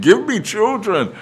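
high, loud desperate demand, easing at the end; demanding outburst. Acted. Jealous Rachel demanding children from Jacob, high loud outburst. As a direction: cry it out as an exasperated demand. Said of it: "all the complaining and all the demanding".

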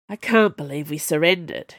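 usual speaking voice

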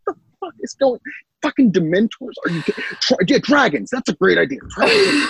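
high, incredulous mock rant; exasperated nurse. Pomfrey ranting at the school's chaos, high incredulous. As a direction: throw up your hands at the madness. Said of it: "And she's like".

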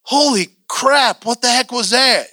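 slow, high-pitched stunned reaction; startled disbelief. Retold or imitated. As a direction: act out being taken aback. Acts out his startled reaction.

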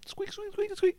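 imitating mice in the cardboard; squeak imitation. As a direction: chirp it like a mouse. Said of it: "wood pallets outside".

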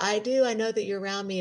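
high mock-weepy voice; imitated tearful breakdown. Niece on phone to crying aunt, imitating tearful reply. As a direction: reassure her through pretend tears. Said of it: "of course, lost it again".

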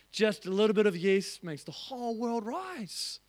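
ordinary delivery

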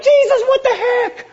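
squeaky, high-pitched indignation; a high, comic "what the heck"; indignant disciples. Retold or imitated. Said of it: "But then they're like".

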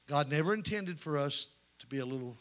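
ordinary talk

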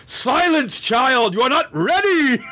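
high, hammed-up wise mentor; imitating a lofty mentor; Professor X. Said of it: "Professor X is like".

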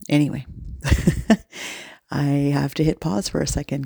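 ordinary delivery

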